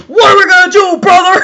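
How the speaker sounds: loud, high wrestler catchphrase shout; Hulk Hogan. Acted. Reader mimicking the wrestler after the costume description, hammed-up shout. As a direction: boom it out like a hyped-up wrestler, breaking into laughter. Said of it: "she dressed up like Hulk Hogan".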